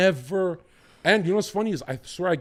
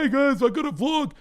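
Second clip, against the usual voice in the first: high, loud vlogger greeting; mock vlogger; 10 semitones higher, 6 dB louder than usual. Mimicking a vlogger to make a point, high and loud. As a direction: put on a chirpy vlog intro voice.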